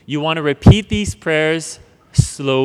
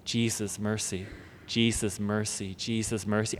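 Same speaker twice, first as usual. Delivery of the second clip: very soft, low, repeated like breathing; whispered repeated prayer; 6 semitones lower, 13 dB quieter than usual. Shown as a demonstration. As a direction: murmur it over and over, almost silent. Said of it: "you're gonna start just saying".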